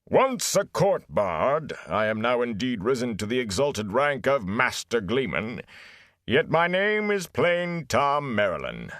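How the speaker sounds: booming bard voice. A narrator's reading. Gleeman proclaims his rank to the villagers, booming. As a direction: declaim as if filling a great hall. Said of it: "voice seemed to reverberate in a great hall".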